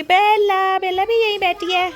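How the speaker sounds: sing-song doting on the dog; doting pet voice. Real, not acted. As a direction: show off your beloved pet. Introducing her dog Bela.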